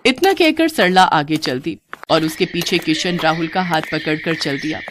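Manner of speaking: ordinary delivery, nothing marked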